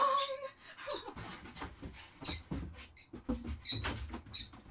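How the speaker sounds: a high, unvoiced nervous laugh; breathy nervous laugh. Real, not acted. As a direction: laugh off the panic. Laugh after panic.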